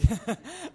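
short laugh. Real, not acted. Laughs about giving everything away.

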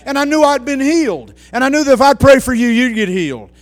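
high, loud revival preaching; fiery testimony from the pulpit; preaching at full force. Acted. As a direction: shout the praise to the rafters.

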